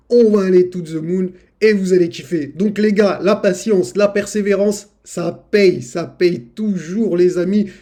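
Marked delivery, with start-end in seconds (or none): none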